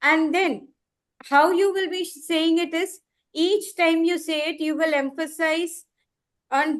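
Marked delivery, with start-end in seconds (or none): none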